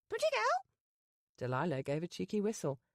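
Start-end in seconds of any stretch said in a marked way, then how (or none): 0.1-0.7 very high squawking parrot. Acted. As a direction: chirp it out like a bird.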